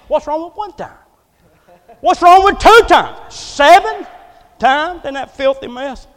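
high, loud, slow outrage; indignant protest. Acted. Naaman balking at dipping in the muddy river, loud and indignant. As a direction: throw each question up in disbelief.

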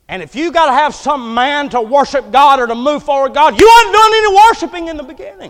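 building to a loud, high rebuke; full-force preaching. Real, not acted. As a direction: preach it hard, climbing to a shout.

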